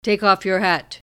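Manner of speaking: gruff officer's command. Retold or imitated. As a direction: bark it out, stern and official. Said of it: "in a deep voice gruffly said".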